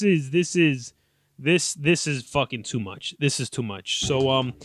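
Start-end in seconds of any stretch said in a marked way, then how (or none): none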